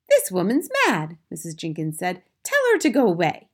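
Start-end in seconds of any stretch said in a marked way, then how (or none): 0.1-1.2 higher, louder lady's voice; indignant wife. A narrator's reading. Missus Jenkins complains about grandmother, high and indignant. 2.4-3.5 high, loud dismissal; indignant wife. A narrator's reading. Missus Jenkins appeals to her husband, high and indignant.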